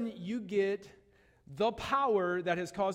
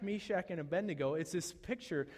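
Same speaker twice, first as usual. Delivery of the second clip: ordinary talk, nothing marked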